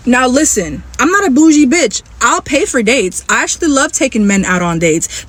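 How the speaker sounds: normal talking voice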